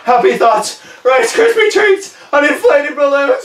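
loud, very high squeal of dread; panicked self-soothing. Acted. Balloon blower seeks happy thoughts, high panicked shouting. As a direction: try desperately to calm yourself and fail. Calms himself while terrified of bang.

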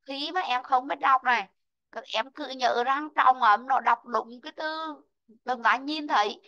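ordinary talk

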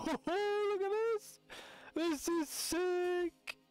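gasping, laughing high excitement; marvel, stretching the words up high; drawn-out squeal of delight; thrilled. Real, not acted. Amazed by a plant.

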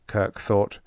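ordinary delivery, nothing marked

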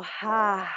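soft breathy "Ha" sigh out of the mouth; open-mouth exhale. Shown as a demonstration. Yoga teacher showing the class an open-mouth exhale, soft breathy Ha. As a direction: let the breath fall out on a soft Ha. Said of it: "Open mouth, exhale".

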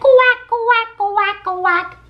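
mama duck quack. Acted. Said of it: "Mother duck said".

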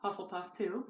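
ordinary talk

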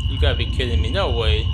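loud disbelieving outburst at the screen; outraged shout. Real, not acted. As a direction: yell it in real outrage. Outraged at character's death.

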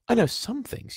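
put-on high boy's voice; defensive boy. A narrator's reading. Boy defends his schooling to the giant, defensive. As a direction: protest in a small, high voice.

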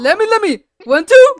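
ordinary delivery

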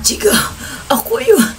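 high, strained and breathless; mock strained voice. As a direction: squeeze it out past a tight throat. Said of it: "Sabi ko". (pigil at mataas na boses, parang hirap huminga)

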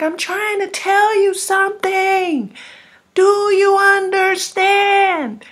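high, loud, slow, like a voice shouting through a far-off radio; distant yelling. Retold or imitated. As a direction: strain to be heard across the distance. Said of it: "yelling like he's far".

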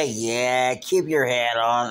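usual speaking voice

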